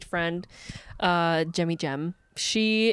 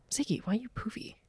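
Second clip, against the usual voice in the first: talking to a pet; 2 semitones higher, 12 dB quieter, much faster than usual. Real, not acted. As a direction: coo at the pet, fast and soft.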